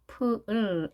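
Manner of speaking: 프을 drawn into a held L; L sound. Shown as a demonstration. Teacher showing the English L, 프을 with held L. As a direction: say 프을 with tongue tip held for L. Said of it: "L 발음이죠".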